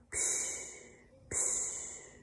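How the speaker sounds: two hushed voiceless pshes; whispery imitated snore; soft snoring. A narrator's reading. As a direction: breathe out like a tiny sleeper. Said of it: "snoring softly in its tiny bed".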